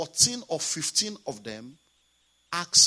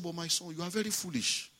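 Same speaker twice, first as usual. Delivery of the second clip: softer, gentle rebuke; quiet voice of God; 7 dB quieter than usual. Retold or imitated. The pastor voicing God quietly scolding him, softer. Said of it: "God said something to me very quietly".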